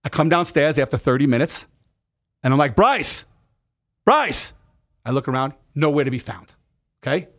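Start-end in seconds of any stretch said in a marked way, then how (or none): 2.8-4.5 put-on high-pitched call for a missing child. Father calls for his son after coming downstairs, calling out. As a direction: holler the name through the house, re-enacted.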